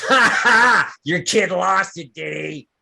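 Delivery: loud laugh into the words; laughing bogan. Acted. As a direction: roar with laughter, mocking. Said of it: "And the Bogan goes".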